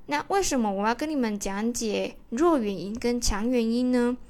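ordinary delivery

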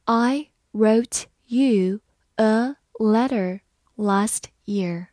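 slow, each word separate; slow reading. Shown as a demonstration. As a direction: read it slowly, word by word. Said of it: "好，慢读一遍". (慢读，逐词停顿)

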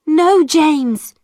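high protest to her brother; crying out. A narrator's reading. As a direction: cry out no at him. I cried.

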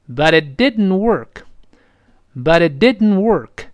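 but it didn't work read very slowly; slow model reading. Shown as a demonstration. Teacher modelling the sentence slowly for learners. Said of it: "鼻子出气儿".

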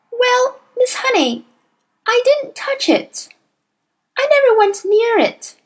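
put-on high, earnest child's voice pleading innocence; a small girl anxious to be believed; little girl Matilda. A narrator's reading. Matilda's lines in the story.

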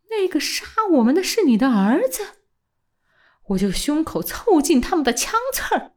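rising, fervent defiance, climbing high; impassioned outburst. A narrator's reading. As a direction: pour it out in a rising passion. Old man's impassioned patriotic outburst. (激昂地一气说完)

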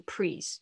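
"please" said as "prees"; R replacing L. Shown as a demonstration. Teacher copies many students' mistaken "please", pronounced "prees". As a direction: swap L for R in "please". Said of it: "错哦".

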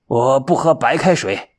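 lightly voiced demanding old monk; fussy old monk. A narrator's reading. Old monk turning down plain boiled water, fussy. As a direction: refuse it like a picky old man.

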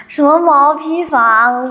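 slow, loud, disbelieving question; indignant surprise. Real, not acted. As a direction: protest in mock outrage. 被说家像毛坯房. (惊讶地拉长反问)

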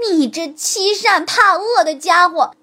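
scornful sneer; contemptuous. A narrator's reading. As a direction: look down on the fox. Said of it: "他用看不起狐狸的口气说". (骨头轻蔑地数落狐狸)

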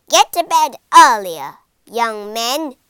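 loud, stern bark of a teacher; teacher barking at a pupil; scolding shout. Acted. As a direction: shout the order across the classroom.

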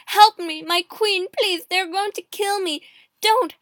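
loud, high, desperate begging; screamed plea. A narrator's reading. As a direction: beg for your life at the top of your voice. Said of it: "he screamed".

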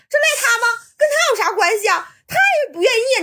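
high-pitched protesting questions; imitated indignant defence. Colleague defending the tenant to the old man, imitated indignantly. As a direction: mimic a colleague sticking up for her.

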